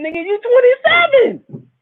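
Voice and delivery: twenty-seven, shrieked up high; incredulous high exclamation. Real, not acted. Mocking a grown man raging at games, high shriek. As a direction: scold him in a high disbelieving voice.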